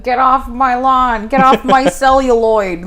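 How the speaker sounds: slow, loud, high-pitched mock old-man yell; yelling cranky old guy. Joking about an old guy yelling at the screen, mock crotchety yell. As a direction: holler like a hammed-up cranky fifty-year-old. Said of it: "a fifty-year-old guy yelling at".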